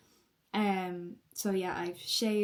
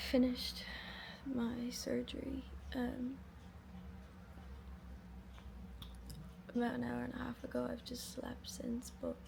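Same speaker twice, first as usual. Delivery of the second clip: weak post-surgery whisper; 3 semitones higher, 9 dB quieter than usual. Real, not acted. Groggy after surgery.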